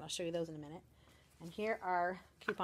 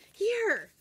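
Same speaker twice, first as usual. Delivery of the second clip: put-on very high, louder kid handing it over; eager child; 13 semitones higher, 9 dB louder than usual. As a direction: thrust it out like an excited little girl. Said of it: "And she goes".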